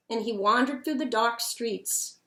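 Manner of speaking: ordinary delivery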